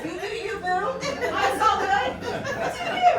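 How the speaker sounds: lightly hammed-up saying; playful catchphrase. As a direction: toss it off playfully. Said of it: "mine is".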